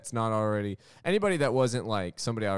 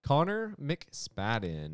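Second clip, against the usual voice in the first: drawn-out, deliberate enunciation; very slow name read-out; slow, careful name; 5 semitones higher, much slower than usual. Real, not acted. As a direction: say it slowly so they can note it. Repeats name to be written down.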